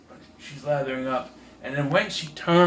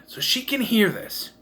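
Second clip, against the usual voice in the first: ordinary talk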